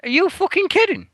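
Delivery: high-pitched, disbelieving cry; incredulous outburst. Retold or imitated. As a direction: act out the shock of seeing the new kit. Retold disbelief at yellow kit.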